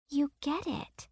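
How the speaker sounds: put-on soft, slow, high girl's voice; a girl in quiet wonder; quiet little girl. A narrator's reading.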